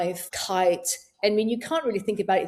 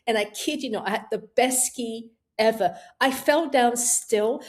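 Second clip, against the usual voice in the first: usual speaking voice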